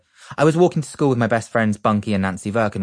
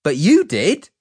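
arguing back; 9 semitones higher, 7 dB louder, slower than usual. A narrator's reading. Child arguing with mum.

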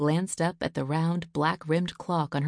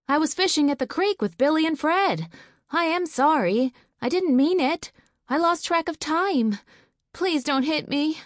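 put-on high, louder scared boy; frightened pleading; 10 semitones higher, 5 dB louder than usual. Son begging his father not to hit him, high and frightened. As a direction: beg not to be whipped.

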